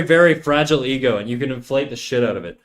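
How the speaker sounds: ordinary talk, nothing marked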